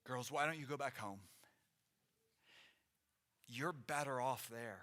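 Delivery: a subdued, mildly sorrowful plea; grieving. Acted. Naomi urging the girls home from her grief, subdued. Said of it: "She's responding from her grief".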